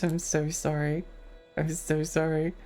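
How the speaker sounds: ordinary talk, nothing marked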